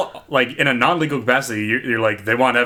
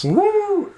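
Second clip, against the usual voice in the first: high whooped "woo"; high-pitched woo. Real, not acted. Reacting to negative four degrees, high woo.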